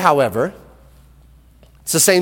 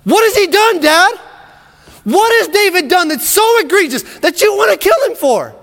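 much higher and louder, indignant son; angry retort; 14 semitones higher, 4 dB louder than usual. Acted. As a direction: throw it back at your father. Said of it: "Fires back at him".

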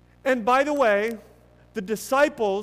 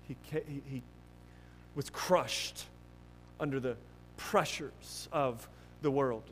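softer and lower, sinking almost to a murmur; softly grieving storyteller voice; hushed and grave; 7 semitones lower, 9 dB quieter than usual. Acted. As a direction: let the sorrow pull the voice down. Somber retelling of Peter's weeping.

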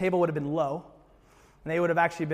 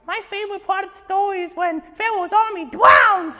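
put-on much higher, louder child's voice; little kid; 8 dB louder than usual. Little kid at the Passover table loving the drowning part, high gleeful child voice. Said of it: "You imagine these little kids".

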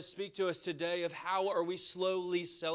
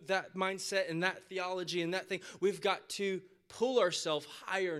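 normal talking voice

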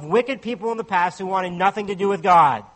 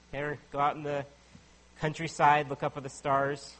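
normal talking voice

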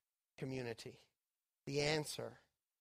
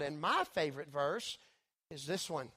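ordinary delivery

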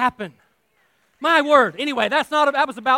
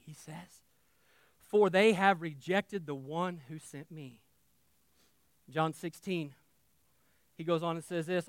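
soft, measured Bible reading; scripture reading; 2 semitones lower, 6 dB quieter than usual. Acted. Preacher reading Jesus' words to the church, softly. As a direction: read the verses softly to the flock.

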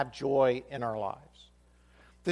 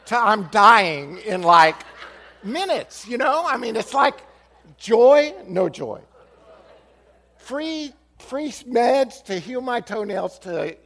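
mock dramatic; 7 semitones higher, 10 dB louder, slower than usual. Mock doom over a free study.

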